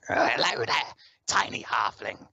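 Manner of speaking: rasping goblin voice, slow on the last words; throaty, drawn-out creature greeting; raspy goblin. Acted. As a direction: rasp it out like a little goblin. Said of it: "he's got a very raspy voice".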